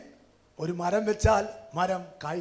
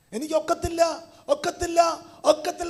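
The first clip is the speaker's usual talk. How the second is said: mock whining; 8 semitones higher, 4 dB louder than usual. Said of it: "എന്ന് പറഞ്ഞുകൊണ്ടിരുന്നാൽ".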